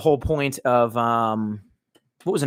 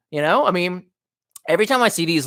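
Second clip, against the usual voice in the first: normal talking voice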